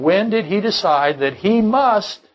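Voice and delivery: "must" stretched high and slow, leaned on hard; slow the line and land hard on "must"; emphasis on must. Shown as a demonstration. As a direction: drive the point home on "must". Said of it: "notice the emphasis, emphasis on must".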